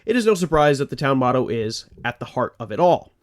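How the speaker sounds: ordinary talk, nothing marked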